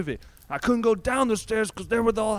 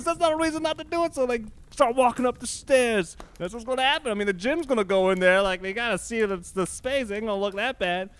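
ordinary delivery

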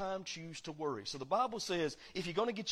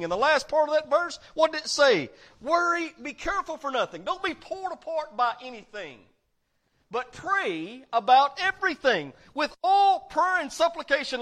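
loud, higher preaching read, climbing in force; full-force preaching; 8 semitones higher, 10 dB louder than usual. Acted. Preacher booming scripture to the congregation, full force. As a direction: preach the verse out to the whole church.

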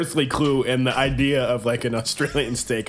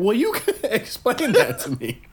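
laughing; 10 semitones higher than usual. Real, not acted. Laughing about shrimp on barbie.

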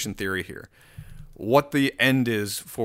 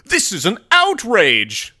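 mock outrage; 7 semitones higher, 8 dB louder, slower than usual.

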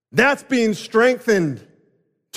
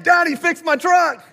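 put-on much higher, louder whiny kid voice; crying boy; 11 semitones higher, 4 dB louder than usual. Son ignores the fortune and begs dad, high whining wail. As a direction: keep wailing like a little boy. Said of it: "He's gonna keep crying".

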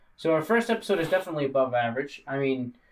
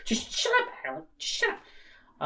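laughing; 14 semitones higher, 4 dB quieter than usual. Pretend. Laughing mock scolding at Alec.

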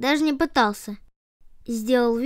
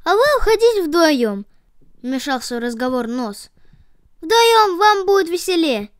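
loud cheerful nose; 5 semitones higher, 5 dB louder than usual. A narrator's reading. Nose cuts in loudly.

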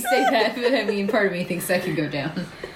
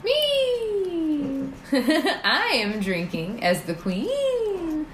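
regal queen voice; 9 semitones higher, much slower than usual. Acted. Hammy queen entrance.

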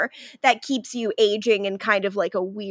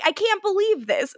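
higher-pitched, understated incredulity; mild disbelief; 9 semitones higher than usual. Retold or imitated. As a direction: quote her disbelief a little higher, without yelling. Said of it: "her disbelief is like".

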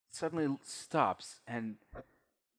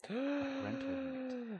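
high, slow mock-ominous; 13 semitones higher, 7 dB quieter, slower than usual. GM's odd high drawn-out phrase.

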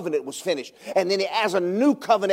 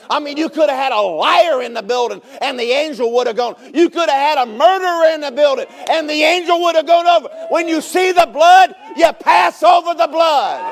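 from loud to fully shouted, higher; building to a shout; 7 semitones higher, 7 dB louder than usual. Acted. Preacher to congregation about passover, building shout. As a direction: preach it up into a roaring climax.

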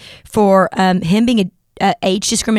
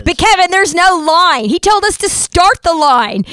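worked-up indignation; 8 semitones higher, 3 dB louder, slower than usual. Retold or imitated. Outraged retelling of bouncer.

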